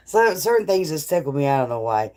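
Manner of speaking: ordinary talk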